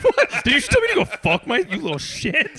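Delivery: high, fast, hammed-up outrage; pretend-furious parent scolding the kid; mock outrage. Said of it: "I'm like".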